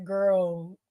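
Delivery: put-on low, dry, unimpressed "Girl". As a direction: give her a flat side-eye "Girl". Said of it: "And I was like".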